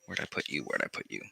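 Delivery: very quiet, low murmur; half-voiced search mumble under the breath; muttering to oneself. Real, not acted. Presenter hunting through a reference book, muttering to self.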